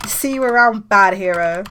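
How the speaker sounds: loud, jeering send-off; parting taunt. Acted. Lars flying away from rival, taunting. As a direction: call it out as a taunt.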